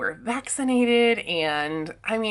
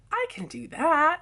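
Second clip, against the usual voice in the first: excited; 9 semitones higher than usual. Retold or imitated. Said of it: "And I was like".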